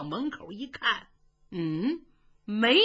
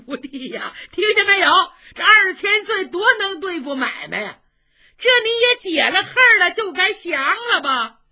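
gleeful; 9 semitones higher, 9 dB louder than usual. Acted. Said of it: "程咬金一听这个乐呀".

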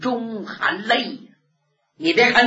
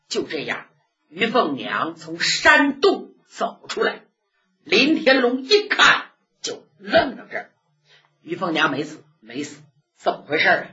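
usual speaking voice

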